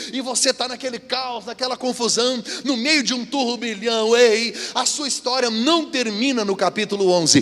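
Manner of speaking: mounting pulpit voice; preaching build. Acted. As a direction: build to the climax.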